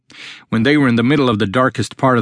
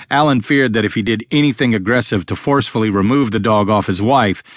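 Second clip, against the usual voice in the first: ordinary delivery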